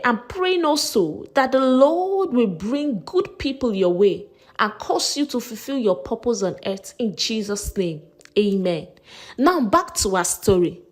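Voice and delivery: ordinary delivery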